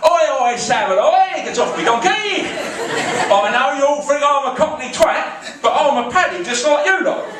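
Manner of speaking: hammed-up London lad shouting over the pub; Cockney in a Belfast pub. Londoner shouting to Belfast drinkers, hammed-up Cockney. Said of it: "with an accent like mine".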